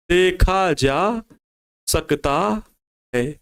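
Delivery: very slow, word by word for note-taking; slow dictation. Shown as a demonstration. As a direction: dictate it slowly so they can write. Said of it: "ध्यान से देखें उत्तर में क्या लिखना है". (धीमे-धीमे लिखवाते हुए)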